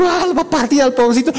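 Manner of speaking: voz de padre preocupado, más aguda y rápida; worried parents. Retold or imitated. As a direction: alarmado por el pobre pichón, rápido y agudo. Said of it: "Y ellos dicen". (los palomos padres se asustan: voz aguda y rápida)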